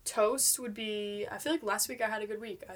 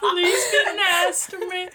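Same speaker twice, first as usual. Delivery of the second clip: laughing plea; 10 semitones higher, 8 dB louder, much slower than usual. Acted.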